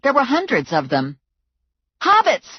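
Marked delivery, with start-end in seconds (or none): 2.0-2.5 much higher, loud alarm call; yelling. A narrator's reading. Heroine yelling to Muner, loud and alarmed. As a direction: shout it across the room. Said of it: "I yelled to Muner".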